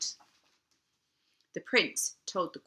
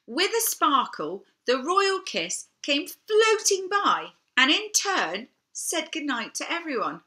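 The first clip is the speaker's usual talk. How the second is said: magical; 8 semitones higher, 3 dB louder than usual. A narrator's reading. Magical kiss arrives.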